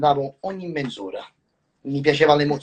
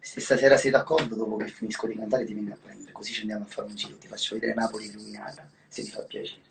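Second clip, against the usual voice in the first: soft seductive; 8 semitones lower, 6 dB quieter, faster than usual. Acted. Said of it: "Invitala ad uscire".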